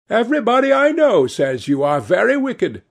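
put-on high, loud old-lady cry; old lady's high-pitched accusation. A narrator's reading. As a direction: cry it out high in mock reproach. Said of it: "cried the old lady".

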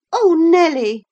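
high, loud petulant outburst; peevish girl's exclamation. A narrator's reading. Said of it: "she added petulantly".

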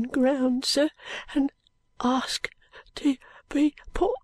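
put-on high, weak Cockney boy voice; frail boy, slow and faint; dying street boy. A narrator's reading.

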